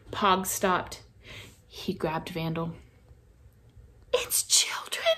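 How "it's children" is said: breathless frightened whisper-squeak; scared squeak. A narrator's reading. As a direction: gasp it out in fright. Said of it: "squeaked Pog".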